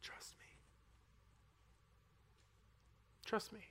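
whispered, barely voiced; whispered God voice. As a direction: breathe it out as God's quiet answer. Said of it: "This is what I got from the Lord".

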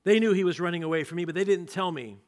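loud, fast furious order; ordering kill. Acted. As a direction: command the guards. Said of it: "He said to all the men".

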